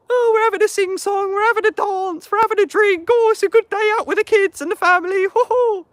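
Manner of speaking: hammed-up falsetto parody of a naive marcher; mocking cheerful voice. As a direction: send up the happy family crowd in a silly high voice. Mocking a cheerful rally crowd.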